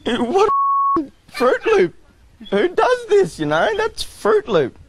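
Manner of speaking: very high, squeaky disbelief; incredulous. Real, not acted. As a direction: pitch way up in outrage. Neighbour shot at his car.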